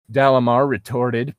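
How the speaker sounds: ordinary talk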